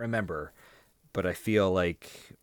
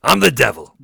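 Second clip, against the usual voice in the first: loud Pacino devil boast; 5 semitones higher, 13 dB louder than usual. Retold or imitated. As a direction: roar it like a grandstanding devil. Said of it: "I can't go full Pacino".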